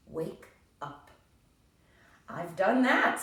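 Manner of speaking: lightly voiced Toad, pleased; a hint of satisfied Toad; Toad's voice. Teacher voicing Toad crossing off his list, lightly pleased.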